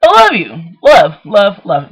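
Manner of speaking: shower them with loud love; gushing goodbye. Real, not acted. Loud affectionate farewell.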